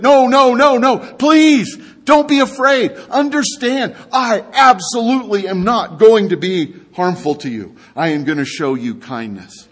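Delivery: no no, please, don't be scared of me; urgent reassurance. Acted. David calms frightened Mephibosheth.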